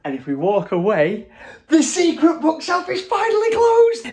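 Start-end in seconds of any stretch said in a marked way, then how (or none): 1.7-4.1 high, thrilled and louder; excited. Real, not acted. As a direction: gush over the reveal. Thrilled reveal of bookshelf.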